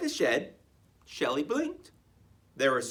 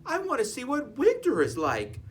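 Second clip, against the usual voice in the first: put-on high little-girl tortoise voice; young Shelley; 9 semitones higher than usual. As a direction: plead like a small child.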